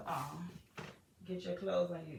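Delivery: ordinary talk, nothing marked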